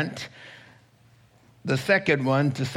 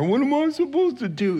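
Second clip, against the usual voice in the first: whiny protest; 11 semitones higher, faster than usual. Acted. Disciples protesting leaving their dad.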